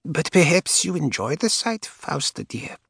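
put-on old woman's cold, dry whisper. A narrator's reading. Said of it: "in a cold, dry whisper".

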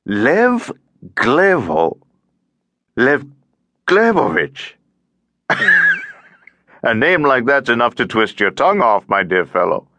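lively, swooping voice of a chatty man; tiresome cheerful stranger. A narrator's reading. Chatty stranger tries out his new acquaintance's name, tiresomely cheerful. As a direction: play the cheery lift bore, rising and falling. Plays talkative stranger in lift.

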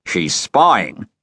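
usual speaking voice

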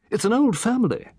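put-on a chatty old gentleman relishing his tale; Satterthwaite. Satterthwaite resumes.

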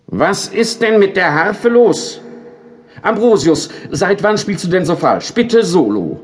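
high, loud indignant conductor; stern conductor. A narrator's reading. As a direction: demand an answer, irritated. Conductor scolds the harp angel.

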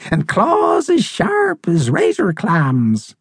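high, drawn-out bird voice; old crusty seabird, ominous. Acted. Seabird adds frightening details of the monster, slow and ominous. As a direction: slowly pile on the menace.

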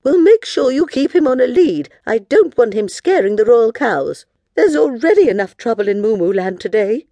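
bossy queen, high and loud; Queen Moo. Acted. Queen warning the girls about their dog, bossy and loud. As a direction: give orders in a moody royal voice.

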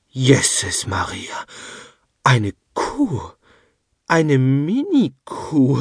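put-on old woman's astonished exclamation; astonished cry. A narrator's reading. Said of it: "rief Oma Krempel verblüfft".

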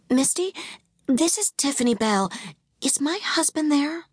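put-on high, tense young wife; urgent, strained and pleading; anxious woman. A narrator's reading. Tiffany pleads on the phone.

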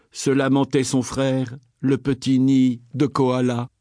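ordinary delivery, nothing marked

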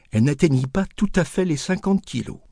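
ordinary delivery, nothing marked

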